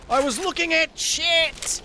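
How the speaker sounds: very high, loud, drawn-out protest; indignant yell. Real, not acted. Gamer attacked while looking away, indignant yell. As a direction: wail it loudly at the game.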